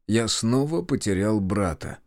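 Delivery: ordinary delivery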